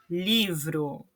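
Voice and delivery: livro set apart, weight on li; stress on first syllable. Shown as a demonstration. Teacher presenting an example word, livro stressed on li. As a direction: stress LI in livro, letting vro fall away. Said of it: "li é a sílaba tônica".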